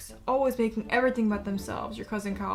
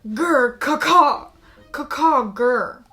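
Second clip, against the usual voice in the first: loud growl breaking into high caws; dinosaur growls and caws; 4 semitones higher, 10 dB louder than usual. Pretend. Player answering the raptor bot with loud growls and caws. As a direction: roar and screech like a raptor.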